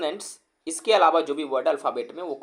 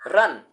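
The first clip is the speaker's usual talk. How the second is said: "रन" in one single punched beat; "रन" set apart as a single beat; one-beat demonstration; 7 dB louder than usual. Shown as a demonstration. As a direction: say "रन" at one go, as one syllable. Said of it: "हमने एक बार में बोल दिया".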